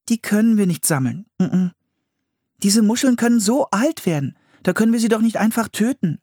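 put-on high-pitched, insistent girl's voice; protesting Anne. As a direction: protest as the dismayed sister. Anne protests to her brother.

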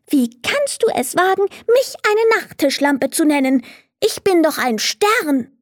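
a proud star outraged at an insult; angry star. Acted. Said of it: "Der helle Stern war mächtig wütend".

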